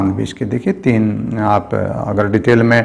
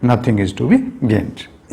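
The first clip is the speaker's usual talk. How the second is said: slow dictation; much slower than usual. Shown as a demonstration. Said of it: "यहाँ पर ये हमारा subject है".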